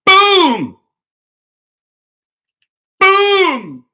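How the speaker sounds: shrieked high, loud cartoon boom; hammer boom. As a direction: yell boom like a hammer blow. Hammering idiots, sound effect.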